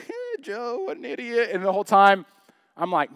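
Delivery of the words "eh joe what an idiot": high-pitched teasing snigger; mocking snicker. Reader chuckling at Job getting scolded, mock derision. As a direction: snigger at someone else's trouble. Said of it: "I'm reading, I'm like".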